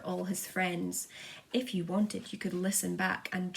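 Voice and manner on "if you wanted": ordinary delivery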